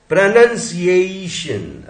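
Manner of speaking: प्रोनंसिएशन slowly, stretching the EI before shun; slow sounding-out. Shown as a demonstration. Teacher presenting the next word to divide, slow demonstration. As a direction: draw out प्रोनंसिएशन, leaning on its EI. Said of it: "अभी देखिए".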